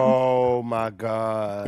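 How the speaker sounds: low, drawn-out disbelief; slow shocked gasp. Real, not acted. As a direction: stretch out the shock slowly. Shocked at betrayal.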